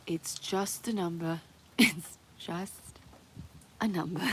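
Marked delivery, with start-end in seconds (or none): none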